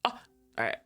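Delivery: normal talking voice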